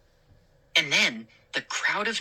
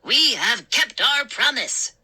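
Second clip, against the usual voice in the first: gnome declaring; 7 semitones higher than usual. A narrator's reading. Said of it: "Chip declared".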